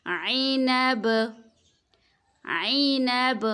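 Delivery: عینب said slowly with the final ب stopped hard; pausal form demo. Shown as a demonstration. Teacher demonstrating the pause form عینب slowly. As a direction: stop on عینب with a clear bounce on ب. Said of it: "وقف کی صورت میں".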